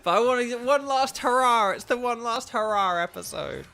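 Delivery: hammed-up big finish; mock-dramatic outburst. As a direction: belt it out as a playful last hurrah. Playful declaration of last hurrah.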